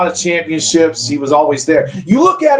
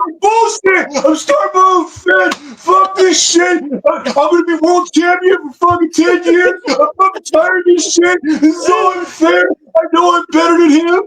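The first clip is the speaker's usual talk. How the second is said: much higher, shouted tantrum voice; whiny wrestler voice; 11 semitones higher than usual. Pretend. Doing the voice of a wrestler angry at the rankings, high shouted tantrum. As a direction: throw a hammed-up fit like a snubbed wrestler. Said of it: "You gotta do the voice".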